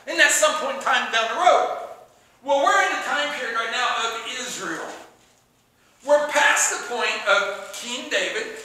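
normal talking voice

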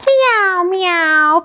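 playful meow. Acted. Greeting a pizza kitty.

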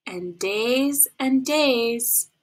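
very slow, stretched words; drawn out. Shown as a demonstration. Storyteller showing patient waiting, very slow. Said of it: "stretching time".

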